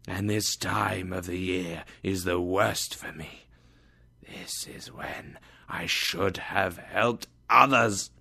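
a ghost breathing out his warning; hushed, hollow spirit voice; ghostly whisper. Acted.

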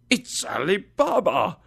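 high, thrilled cry of recognition; excited exclamation. A narrator's reading. Scrooge spots his boyhood storybook hero, excited. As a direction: exclaim it with rising delight. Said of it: "Scrooge exclaimed excitedly".

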